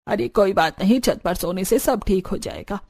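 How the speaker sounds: put-on low-pitched older in-law brushing it off; dismissive mother-in-law. (बात टालती सास की आवाज़)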